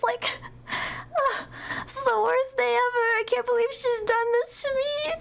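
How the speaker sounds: high, whiny fake sobbing; mock crying. Streamer fake-sobbing to chat about missing the show, high and whiny. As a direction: ham up a fake cry over missing out. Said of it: "I can't even make crying noises".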